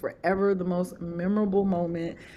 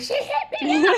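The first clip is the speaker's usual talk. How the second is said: high squeal breaking into laughter; giddy announcement. Retold or imitated. Author telling girlfriend the reader got in touch, giddy squeal. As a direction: re-enact thrilled news, pitched way up. Excited answer to girlfriend retold.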